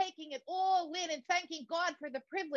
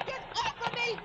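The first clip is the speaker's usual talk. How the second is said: higher, more desperate repeat of the plea; desperate shriek; 10 semitones higher than usual. Acted. Fighting off her attacker.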